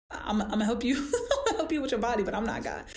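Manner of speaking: laughing through the words; amused laughing protest. Real, not acted. Laughing denial to clients.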